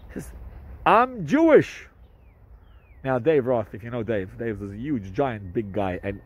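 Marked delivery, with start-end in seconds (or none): 0.8-1.9 emphatic reveal. Retold or imitated. Cop's reveal in retold story.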